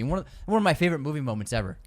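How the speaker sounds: ordinary talk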